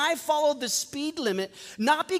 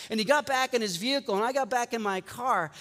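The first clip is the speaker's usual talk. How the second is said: ordinary delivery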